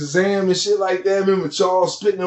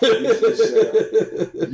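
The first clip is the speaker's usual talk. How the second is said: laughing; 7 semitones higher, much slower than usual. Real, not acted. Laughing while mocking Shaq.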